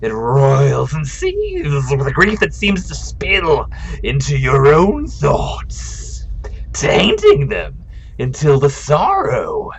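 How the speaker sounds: an ethereal spirit sharing its vision. Acted. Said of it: "we'll do a spirit voice".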